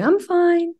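sudden high, sing-song "I'm fine"; mock cheerful reply. Granddaughter to teasing grandmother, high mock-cheerful. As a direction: chirp it brightly as if nothing is wrong. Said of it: "she'd always tease me about".

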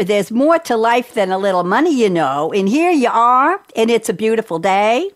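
hammed-up Minnesota accent with rising tune; attempted Minnesota accent. Shown as a demonstration. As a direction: try a Minnesota accent, lifting the phrase endings. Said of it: "do it with your Minnesota accent".

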